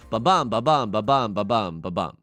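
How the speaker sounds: ba-BUM with the stress on BUM each time; iambic beat. Shown as a demonstration. Explaining pentameter to chat, chanting the beat. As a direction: tap out ba-bum like a metronome. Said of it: "Five beats".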